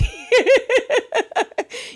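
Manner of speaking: high, rippling giggle; real laugh. Real, not acted. Laughing at commenters missing the point, high giggle.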